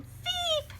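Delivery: very high excited squeak; creature squeak. Acted. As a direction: chirp off as he escapes. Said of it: "he's going".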